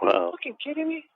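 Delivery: high-pitched disbelief, not shouted; retold incredulity. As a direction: re-enact being stunned by the excuse. Retells his disbelief at brother.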